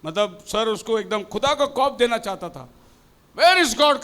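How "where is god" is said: higher, louder stern demand; scolding question. Retold or imitated. Teacher scolding the boy to make him fear God, loud. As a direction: bark the question at the child. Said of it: "करके डांट के पूछा".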